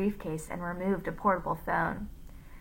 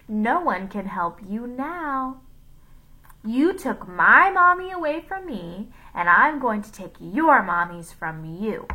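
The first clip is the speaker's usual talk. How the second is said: sneering; 3 semitones higher, 7 dB louder than usual. A narrator's reading. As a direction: threaten them with rising, bitter force. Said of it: "he sneered".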